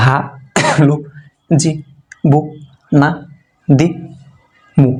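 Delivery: each Arabic syllable clipped short, one by one; quick syllable reading drill. Shown as a demonstration. Teacher to students, modeling quick syllables, clipped and short. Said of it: "সবগুলাই তাড়াতাড়ি হবে".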